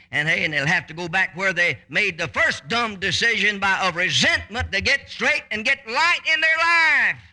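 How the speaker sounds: rising from firm to high, loud preaching; building to shouting preach. Acted. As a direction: climb into a full-voiced preacher's cry. Preaching climax.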